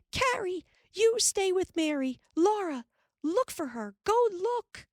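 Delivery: high urgent call; calling back. A narrator's reading. Ma calls orders back to the girls, high urgent. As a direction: shout orders while running. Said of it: "calling back".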